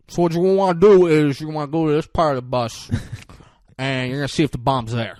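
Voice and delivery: Jeff Daniels impression. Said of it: "He's like".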